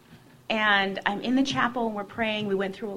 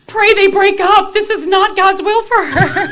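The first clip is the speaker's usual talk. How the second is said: high, loud, hammed-up shriek; mock outraged cry; 13 semitones higher, 17 dB louder than usual. Sister telling the nuns to pray the couple breaks up, high mock shriek. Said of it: "my sister turns around and goes".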